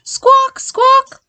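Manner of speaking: high, loud parrot squawks. Acted. Parrot thanking Paddington, high squawking parrot voice.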